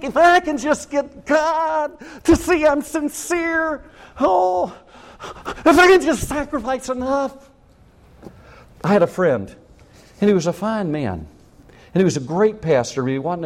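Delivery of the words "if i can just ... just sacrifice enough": high, slow, earnest pleading; pleading worshipper. Acted. Worshipper desperately trying to convince God, high slow plea.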